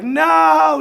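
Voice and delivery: high, loud furious no; angry coach shout. Retold or imitated. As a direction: bark it like a mad coach. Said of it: "He was very mad".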